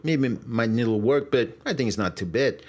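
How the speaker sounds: halting Russian-accented English. Shown as a demonstration.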